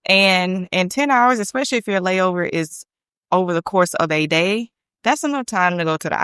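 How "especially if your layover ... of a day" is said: ordinary talk, nothing marked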